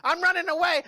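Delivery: high, loud stubborn shout; defiant kid tantrum. Acted. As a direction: stomp off like a rebellious boy. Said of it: "And Bebo's like".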